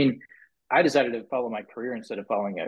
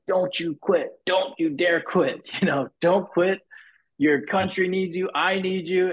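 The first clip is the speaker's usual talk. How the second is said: higher-pitched imitation of a stage rally cry, half-shouted; Dave Ramsey screaming; 9 semitones higher than usual. Imitating the speaker urging the crowd not to quit, higher and half-shouted. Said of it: "he starts s-, screaming at people".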